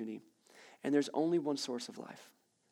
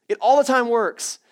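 louder second time, full conviction; louder emphatic repeat; 7 semitones higher, 11 dB louder than usual. Acted. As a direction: repeat it louder, driving it home.

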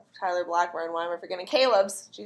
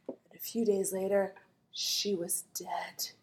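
spooky whisper; 6 dB quieter than usual. Retold or imitated. Fake Mariska warning Spencer about Allie, spooky whisper. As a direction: drop to a creepy ghost-story whisper. Creepy warning quoted from episode.

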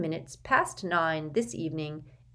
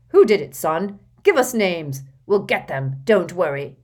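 gruff Uncle Vernon; 9 dB louder than usual. Acted. Vernon reassures son loudly.

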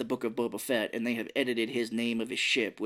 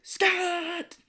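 shrieked name, much higher; mock scream; 3 dB louder than usual. Imitating the screaming lady in the movie, shrieking. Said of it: "she's the lady that screams".

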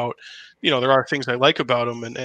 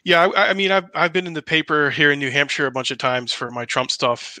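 usual speaking voice

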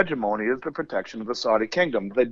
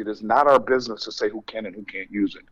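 ordinary delivery

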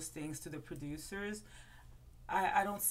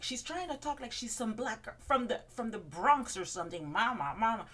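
a lightly higher sassy put-on voice, louder on the mama; mock Ramona voice; 5 semitones higher, 4 dB louder than usual. Ramona mocking Bethany's Bronx talk, lightly put-on and sassy. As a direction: retell the jab again as the diva. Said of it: "but I think she went, like".

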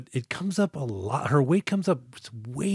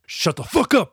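louder, higher mock snap; mock telling off; 6 semitones higher, 5 dB louder than usual. Host voices Scott shutting up the purser, mock snap. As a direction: tell him off with relish. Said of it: "which is like".

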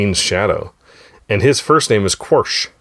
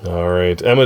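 usual speaking voice

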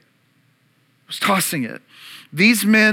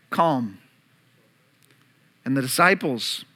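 ordinary delivery

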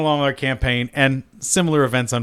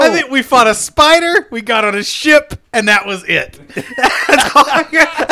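high, loud, breaking into laughter; amused exasperation; 10 semitones higher, 6 dB louder than usual. Real, not acted. Player summing up the meagre campaign, laughing. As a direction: recap through real laughter.

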